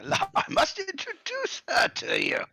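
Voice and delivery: slow, high, loud insistence; excited old man making introductions; eager senile grandfather. Acted.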